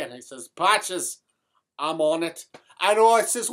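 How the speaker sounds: thick stage Irish brogue throughout. Acted. Comic Irish character telling a tale to the audience in a brogue. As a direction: keep the comic Irish lilt going.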